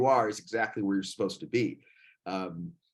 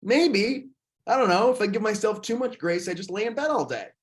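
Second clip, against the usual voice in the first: high-pitched, louder mock hedging; voicing doubters; 9 semitones higher, 3 dB louder than usual. Man mimics people rejecting too much self-grace, high mock protest. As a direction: raise the voice to mimic someone making excuses. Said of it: "I hear they go".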